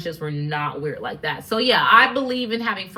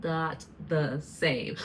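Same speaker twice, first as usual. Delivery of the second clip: ordinary talk, nothing marked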